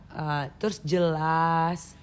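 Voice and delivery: calm slow Indonesian manner; slow clear speech. Shown as a demonstration. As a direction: spell it out slowly and clearly. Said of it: "Kayak Tia ngomong sekarang".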